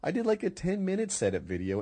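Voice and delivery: ordinary delivery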